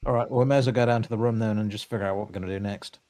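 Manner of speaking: normal talking voice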